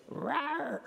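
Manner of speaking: tiny squeaky high roar; little cub's weak attempt at a roar; wimpy kitten roar. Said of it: "Like this tiny little, like, wimpy little cat".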